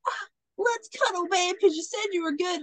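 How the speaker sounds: high, hammed-up eagerness; mock eager cuddling. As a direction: imitate an oblivious husband wanting affection. Retold husband eagerly pounces.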